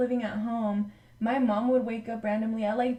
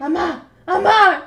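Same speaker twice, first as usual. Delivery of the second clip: louder, higher mock-worried call; raised retold cry; urgent calling; 6 semitones higher, 10 dB louder than usual. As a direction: call out to get an answer. Retold calling mom who won't answer.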